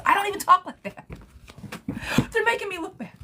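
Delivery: high-pitched indignant complaint; playfully offended at scammers; mock outrage. Real, not acted. Reader complaining about fake accounts, mock outraged. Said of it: "I'm being silly".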